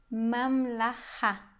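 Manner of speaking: mamlaha stretched out slowly, syllables apart; teacher modelling mamlaha slowly; slow model word. Shown as a demonstration.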